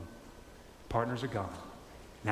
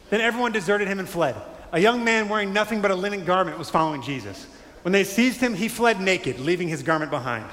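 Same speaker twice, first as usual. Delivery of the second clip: loud, high public reading of the verse; reading scripture with full voice; 6 semitones higher, 7 dB louder than usual. Shown as a demonstration. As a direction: read it out to the room. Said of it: "reads the passage aloud".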